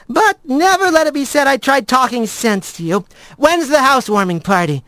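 high, airy backing off; friend giving up. Acted. Blaze gives up arguing with Rat, high and casual. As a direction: start high, then drop lightly into the question.

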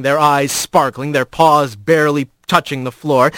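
ordinary delivery, nothing marked

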